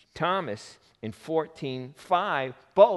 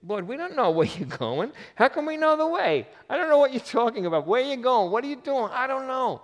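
much higher, loud, rushing confused questions; baffled, high and loud; 13 semitones higher, 6 dB louder than usual. Acted. Thomas protesting to Jesus he doesn't know the way, high and loud. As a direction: sputter out the questions, pitched up.

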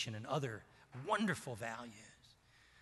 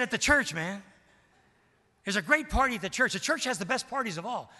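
loud, much higher, delighted; enthusiastic insistence; 8 semitones higher, 9 dB louder than usual. Retold or imitated. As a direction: sell church like the best party. Steve insisting church parties best.

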